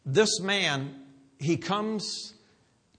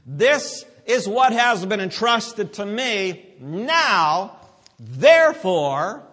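loud commissioning, big pauses between phrases; forceful preaching; 5 semitones higher, 9 dB louder, much slower than usual. Real, not acted. Preacher voices Jesus commissioning disciples, forceful proclamation. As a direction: send them out with force.

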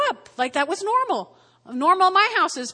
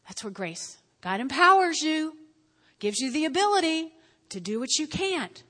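usual speaking voice

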